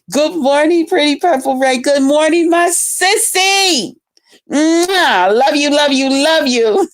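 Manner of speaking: high, sing-song sweet talk; lovey dovey. Real, not acted. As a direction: coo at your bestie. Sweet greeting to a friend.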